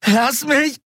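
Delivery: high screamed demand; frantic high-pitched protest; desperate cry. A narrator's reading. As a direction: yell while struggling to get free. She fights him, beating his chest.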